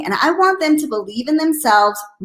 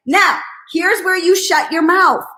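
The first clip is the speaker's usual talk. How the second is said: ordinary talk, nothing marked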